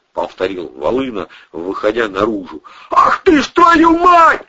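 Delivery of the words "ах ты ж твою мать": high, slow, loud curse; startled shout. A narrator's reading. Man stopping short on the steps, shouting. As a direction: cry out sharply in shock. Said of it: "крикнул он".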